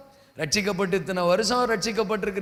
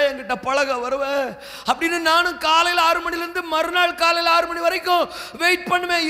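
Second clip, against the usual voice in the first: raised, pleading preacher's voice, pushing up to shouts; preaching as God; 6 semitones higher than usual. Acted. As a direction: plead with the flock as God, at full force.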